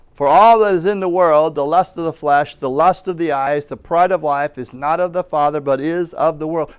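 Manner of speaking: firm, loud reading of a Bible verse; scripture read with force. Acted. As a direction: proclaim the verse to the church. Reads scripture aloud with force.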